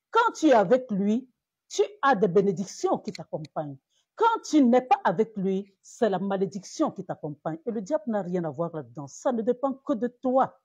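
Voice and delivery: ordinary delivery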